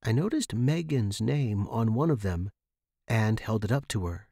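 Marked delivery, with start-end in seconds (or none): none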